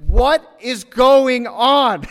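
drawn-out, loud, high astonished cry; bewildered manager. Retold or imitated. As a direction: stretch it out in total disbelief. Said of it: "he goes".

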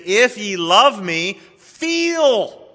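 slow, with "feel" thrown up high in a swoon; gently mocking misquote; mock wrong version. Shown as a demonstration. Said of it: "He said, "Keep".

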